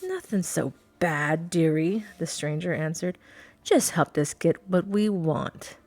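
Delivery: smug, sly captor; smug pirate. Acted. Pirate answers smugly.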